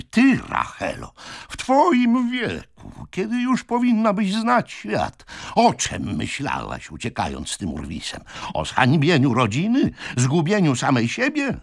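high-pitched indignant reproach; angry scolding. A narrator's reading. Brother berates his eloping sister, angry scolding. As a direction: lay into her, pitch leaping up.

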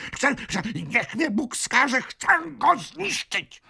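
very high, strained fury; enraged ranting. A narrator's reading. Old man threatens to destroy the vagabond, raging.

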